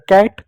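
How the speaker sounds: कैट with an open "ai" vowel; ai vowel sound. Shown as a demonstration. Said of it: "ए की मात्रा लग गया".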